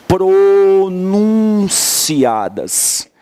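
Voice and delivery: PRONUNCIADAS stretched out very slowly; insistent key-word emphasis. Shown as a demonstration. Teacher insisting students notice this word, drawn-out emphasis. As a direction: hold each syllable of "Pronunciadas" long. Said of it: "preste muita atenção nessa palavra".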